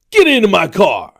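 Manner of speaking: hammed-up, high, bellowed command; aggressive shout. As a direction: yell the song line like an abduction order. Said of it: "That's a little bit too aggressive".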